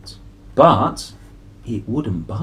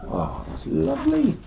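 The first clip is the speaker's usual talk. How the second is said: delighted coo; 14 semitones higher than usual. Real, not acted. Admiring final picture.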